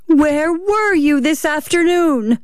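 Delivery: slow, high interrogation; accusing. Acted. Wife interrogating her husband about his afternoon, slow and accusing. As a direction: draw the question out, high and accusing.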